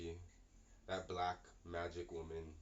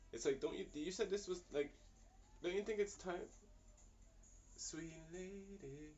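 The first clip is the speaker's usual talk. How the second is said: normal talking voice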